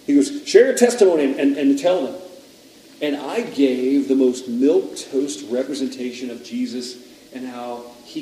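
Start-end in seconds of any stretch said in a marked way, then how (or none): 0.5-2.2 urgent command. Acted. Indian man urging him to preach.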